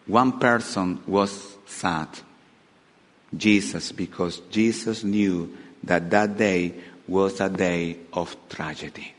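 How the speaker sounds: low, soft, slowing into sadness; somber. Real, not acted. Preacher telling of Jesus knowing tragedy, low and somber. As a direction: let the voice sink gravely.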